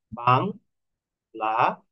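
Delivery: "Bangladesh" split into three slow, separate syllables. Shown as a demonstration. Teacher showing the steps in pronouncing "Bangladesh", slowly syllable by syllable. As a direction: break "Bangladesh" into বাং, লা, দেশ with gaps. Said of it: "আমাকে কিভাবে উচ্চারণ করতে হচ্ছে".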